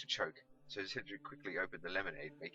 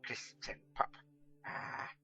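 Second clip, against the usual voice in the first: a lightly voiced old wizard drilling the words into memory, slowing at the end; muttered repeating; much slower than usual. A narrator's reading. Catweasel repeating the boy's order on the way to the pub. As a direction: chant it over and over so as not to forget. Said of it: "endlessly repeating".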